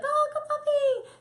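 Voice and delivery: very high, slow cooing praise; sing-song baby talk to a puppy; high sweet praise. Shown as a demonstration. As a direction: coo it to the puppy. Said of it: "you're like".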